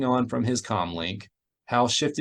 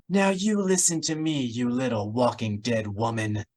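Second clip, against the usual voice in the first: a furious threat through the comlink; bitten out. A narrator's reading. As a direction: bite each word off in anger. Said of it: "she bit out".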